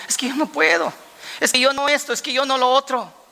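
a hint of a nervous, reluctant Moses; Moses making excuses. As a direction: play Moses pleading he can't do it. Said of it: "Moisés dijo".

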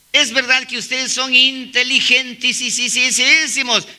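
slow, exaggerated praise with stuttered extra syllables; mock flattery. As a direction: drag out the superlative in sarcastic praise. Mocks listeners as super intelligent.

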